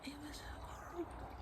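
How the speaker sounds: whisper. Real, not acted. Whispering about yesterday's rain.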